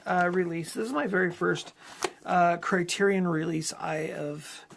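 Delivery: ordinary talk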